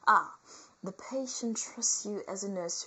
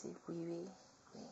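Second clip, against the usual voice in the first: fake French accent; 2 semitones lower, 12 dB quieter, faster than usual. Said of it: "with my fake, uh, French accent".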